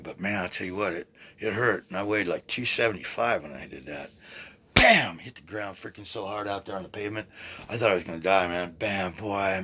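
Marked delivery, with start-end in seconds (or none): 4.7-5.2 loud, high "bam" for a crash; imitated impact bang. Man retelling his fall on the pavement, imitated bang. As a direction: punch out the sound of a body hitting pavement.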